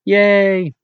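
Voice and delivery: playful cheer. Pretend. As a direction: give a brief celebratory cheer. Mock cheer for the Dutch word.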